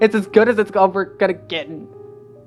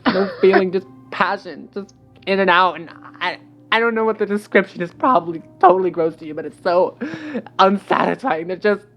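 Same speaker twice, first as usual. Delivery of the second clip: agitated venting; 8 semitones higher, 9 dB louder than usual. A narrator's reading. She vents about her sex life.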